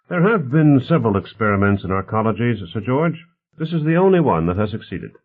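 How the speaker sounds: lively, mildly voiced character dialogue; affable host Bonner. Bonner talks politics with his guests, lightly voiced affable host.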